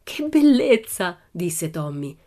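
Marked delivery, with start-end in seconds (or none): 0.0-1.2 delighted. Acted. Tommy sees gold coins.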